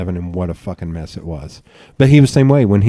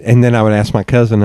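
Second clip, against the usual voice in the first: ordinary delivery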